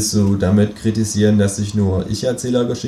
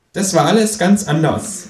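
kangaroo voice; 9 semitones higher, 3 dB louder than usual. Acted. Känguru footnote.

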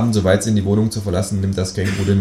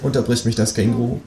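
ordinary talk

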